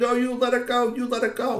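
put-on high, straining child troll voice; insistent demand. A narrator's reading. Girl hanging on to the backpack, insistent. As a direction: plead and demand on a high held note.

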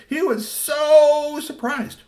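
very high, slow squeal; mock surprise. A narrator's reading. Narrator to kids, hammed-up surprise. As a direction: stretch out the surprise.

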